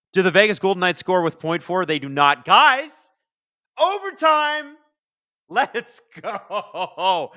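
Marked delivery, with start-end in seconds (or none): 2.4-7.3 high, loud cry of joy; overtime excitement. Real, not acted. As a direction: shout it to the chat, thrilled. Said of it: "game goes to overtime".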